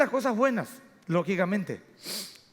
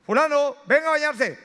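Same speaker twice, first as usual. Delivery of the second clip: louder, higher call of a father summoning his son; father's call to bathe; 5 semitones higher, 5 dB louder than usual. Retold or imitated. Father calls his son to come bathe, firm raised call. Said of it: "llega tu papá y le dice".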